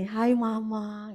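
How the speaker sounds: slow, drawn-out little hello; a frail child waking up and greeting mama; weak child's greeting. Retold or imitated. Recovering daughter greets her mother, slow and tender.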